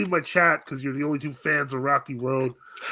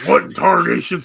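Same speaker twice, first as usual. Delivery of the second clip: loud southern cartoon exclamation; Foghorn Leghorn; 4 semitones higher, 8 dB louder than usual. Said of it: "Father's getting southern".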